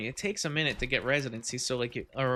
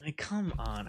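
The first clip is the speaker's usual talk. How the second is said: whispered aside; 2 semitones higher, slower than usual. Real, not acted. Aside while retelling hiring trouble.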